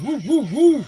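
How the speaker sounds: high whooping cheers; excited woo. Real, not acted. Hyping the guys with excited whoops. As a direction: whoop it up like a hype man.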